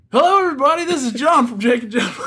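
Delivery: high, loud, laughing hello; excited intro. Acted. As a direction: burst in with a giddy high greeting. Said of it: "you've had your time to shine".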